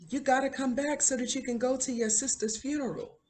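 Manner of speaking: high, sustained pleading call; retold yell. Schoolmaster urging rowing boy back to funeral, retold high call. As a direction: imitate calling someone back from a distance. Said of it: "He's yelling at him".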